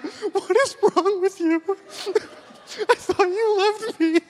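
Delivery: very high, drawn-out mock wail; imitated crying wife. Husband imitating his wife crying in the car, mock wailing. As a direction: wail it as a hurt, sobbing wife. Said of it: "starts crying in the car".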